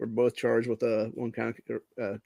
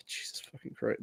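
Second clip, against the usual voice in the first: exasperated whisper; 4 semitones lower, 14 dB quieter than usual. Real, not acted. Sigh at fraud news.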